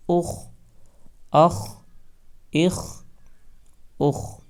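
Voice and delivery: اخ with a full, heavy خ; heavy kha. Shown as a demonstration. Teacher drilling students: heavy خ in اخ. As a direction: read the خ in اخ thick. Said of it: "خا کو موٹا پڑھیں گے".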